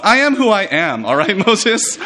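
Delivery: put-on high, sassy teenage retort; pubescent sass. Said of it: "pubescent kind of response".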